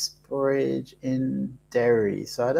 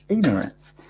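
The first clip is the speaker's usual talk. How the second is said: sheepish whisper. Real, not acted. Apologising for not knowing Ireland.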